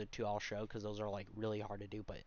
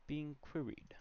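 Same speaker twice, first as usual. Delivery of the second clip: British-sounding; 5 semitones higher, 3 dB quieter, slower than usual. Real, not acted. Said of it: "sounds kind of British".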